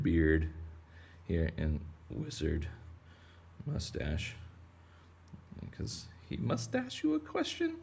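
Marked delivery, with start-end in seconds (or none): 5.7-7.8 pun delivered way up high, jokey; cheesy pun. Real, not acted. Drawing a wizard whale, cracking a lame pun in a high voice. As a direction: squeak the pun like a dad joke. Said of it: "Sorry, that was lame".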